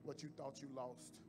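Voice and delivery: soft, low, intimate sermon; hushed preaching. Acted. Pastor softly telling the church God's promise. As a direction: lean in and speak it gently.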